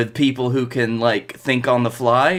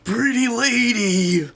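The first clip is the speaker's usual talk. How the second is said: goofy voice; 9 semitones higher, slower than usual. Acted. Goofy catchphrase voice.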